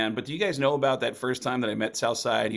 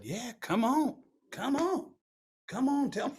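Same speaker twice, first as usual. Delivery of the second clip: Southside voice; 12 semitones higher than usual. Retold or imitated. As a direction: gently coax him to open up. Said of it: "And he said".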